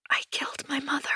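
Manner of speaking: hushed, high confession whisper. A narrator's reading. Anna confesses to husband on the phone, trembling whisper. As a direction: breathe the confession out, barely voiced. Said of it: "I whisper".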